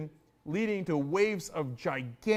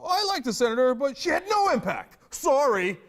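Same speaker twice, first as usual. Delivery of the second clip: mocking tweet; 8 semitones higher, 6 dB louder than usual.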